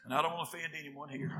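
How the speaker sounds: ordinary talk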